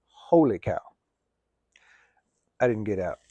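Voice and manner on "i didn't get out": ordinary talk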